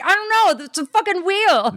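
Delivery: high-pitched, exasperated protest; exasperated outburst. Retold or imitated. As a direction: blurt it back at pestering friends, pitch way up. Exasperated reply to pressing friends.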